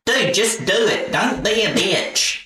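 put-on teenage self in high Southern twang; Southern accent. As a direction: egg on a buddy in a high Southern voice. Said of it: "The reason I stopped having a Southern accent".

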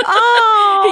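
high squealing oh with a laugh; delighted gasp. Real, not acted. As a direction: squeal it, half laughing, half touched. Friend reacts to compliment story.

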